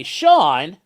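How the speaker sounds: high, loud skeptical objection; a hint of a doubting viewer; mock skeptic viewer. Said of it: "some of you might say".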